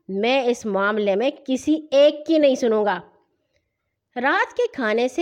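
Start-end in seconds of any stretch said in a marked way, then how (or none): none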